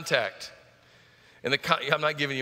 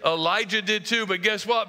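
ordinary talk, nothing marked

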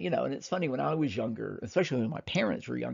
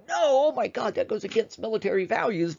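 outraged; 8 semitones higher, 3 dB louder than usual. Retold or imitated. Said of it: "And people are like".

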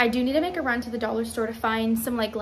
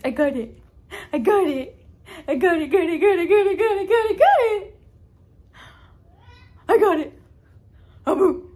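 rising, louder playful chant; 7 semitones higher, 9 dB louder than usual. Real, not acted. Playing with baby.

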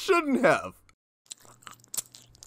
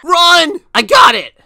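2 much louder urgent yells; 3 shout the warning while fleeing; 4 loud desperate orders; 1 panicked shouting; 8 semitones higher, 9 dB louder, slower than usual. Acted. Fleeing the chain chomp.

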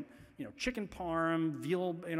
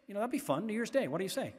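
mock cheery dad; 6 semitones higher than usual. Dad cheerfully proposing resolutions at dinner.

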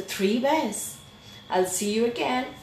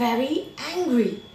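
plain reading voice

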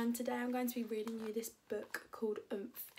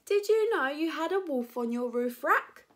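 loud, higher shouted question; calling out; 4 semitones higher, 9 dB louder than usual. Acted. Max to departing Preston, loud calling question. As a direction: call it after a moving car.

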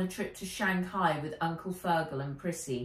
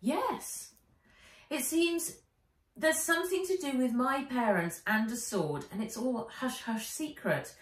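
put-on high, hushed girl; secretive young girl; 6 semitones higher than usual. Nat explains.

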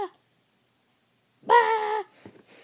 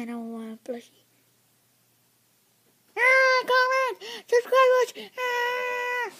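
whiny begging; 4 semitones higher, 5 dB louder than usual. Pretend. Begging viewers for comments.

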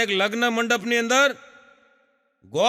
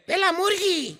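put-on much higher little boy; child voice; 11 semitones higher than usual. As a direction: answer proudly like a kid. Boy answers teacher. (શિક્ષકને જવાબ આપતો છોકરો, ઊંચા અવાજે)

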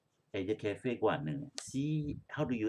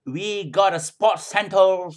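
slow, louder "We got a sports centre"; slow repeat of the recording; 8 semitones higher, 13 dB louder, slower than usual. Retold or imitated. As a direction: echo the recording slowly for the class. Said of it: "in our house ke?".

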